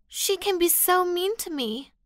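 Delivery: high, hammed-up sad whine; fake sad whine. A narrator's reading. Sandra playing the victim to the teacher, fake sad whine. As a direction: fake a wounded sniffle. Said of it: "faking a sad face".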